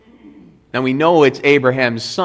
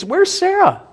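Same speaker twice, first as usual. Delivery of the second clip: a much higher, changed voice for "Where's Sarah?"; visitors asking. Acted. Preacher voicing visitors, pitched well up. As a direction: squeak it up like a pointed question. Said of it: "the first thing they bring up is".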